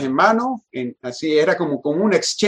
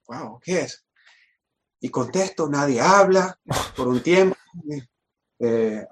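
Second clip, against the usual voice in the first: ordinary delivery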